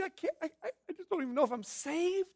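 high, soft, stammering sob; tearful, halting and high-pitched, acted out; mock sobbing. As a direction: imitate someone crying too hard to talk. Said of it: "super sobbing".

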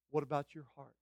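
ordinary delivery

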